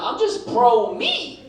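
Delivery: very slow, high and loud; drawn-out punchline. Acted. Comic telling the crowd, slow drawn-out punchline. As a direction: stretch every word for the laugh.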